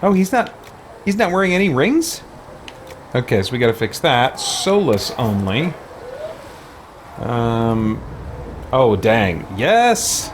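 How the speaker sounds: usual speaking voice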